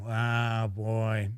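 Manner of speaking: ordinary talk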